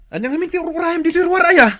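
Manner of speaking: high, fast, loud exasperated scolding. Acted. Husband scolds wife loudly and fast. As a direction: snap at her in disbelief.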